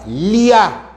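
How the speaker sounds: LIA alone, drawn out as one syllable. Shown as a demonstration. Teacher counting the second syllable of Julia, syllable by syllable. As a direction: glide through LIA as a separate second syllable.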